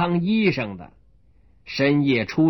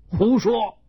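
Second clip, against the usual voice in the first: angry shout; 7 semitones higher than usual. Acted. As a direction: bark at him in anger. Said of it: "大声喝道".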